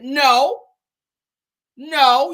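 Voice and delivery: loud real no, no; firm loud no. Real, not acted. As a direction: shut the excuse down hard. Scolding the guest's excuse.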